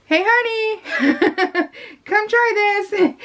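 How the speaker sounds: high, slow, sing-song lure with a laugh; sweetly bait him into tasting it; calling the husband over to try the awful fruit, sly and sugary; sly coaxing. Retold or imitated. Luring husband to taste bad food.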